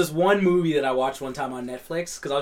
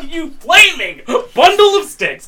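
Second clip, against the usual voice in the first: higher, louder, slow laughing jab; mock insult; 10 semitones higher, 7 dB louder, slower than usual. Real, not acted. Ribbing a friend who doesn't know Kaufman, loud. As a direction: hurl it as a joking insult.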